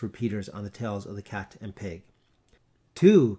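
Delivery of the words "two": loud, booming count; the big bell. A narrator's reading. Big bell striking over the town, loud. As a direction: toll it out like a great bell. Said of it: "continued the big bell".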